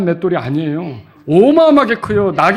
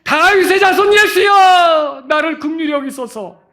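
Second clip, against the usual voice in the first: high, slow pleading shout that fades at the end; desperate cry; 9 semitones higher, slower than usual. Acted. Blind beggar crying out to Jesus, a slow desperate shout. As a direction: cry out for mercy with everything you have. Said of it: "목이 터지죠".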